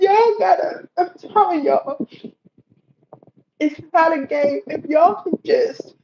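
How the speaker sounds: high, tearful pleading with a breaking voice; crying. Real, not acted. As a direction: beg them through real tears. Pleading with viewers about God.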